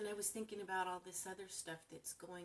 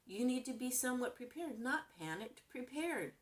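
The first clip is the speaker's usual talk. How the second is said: "prepared" pushed higher and harder; stress on prepared; 5 semitones higher than usual. Real, not acted. A woman urging her viewers, stressing "prepared". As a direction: lean on "prepared", not "panicked". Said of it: "The P word".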